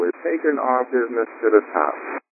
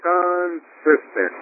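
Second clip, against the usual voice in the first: slow, higher echo of consistent; echoed declaration; 7 semitones higher, much slower than usual. Shown as a demonstration. Co-host echoing the host, slow and lifted. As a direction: answer back with conviction. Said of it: "echoing the host's affirmation".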